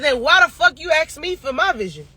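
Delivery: normal talking voice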